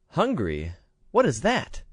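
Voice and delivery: put-on high, wondering child's question; a pampered girl who has never known hunger; puzzled little princess. A narrator's reading.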